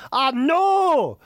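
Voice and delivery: hammed-up Scottish, very slow; terrible Scottish accent. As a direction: wail it in a badly faked Scottish accent. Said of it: "I do a terrible Scottish accent".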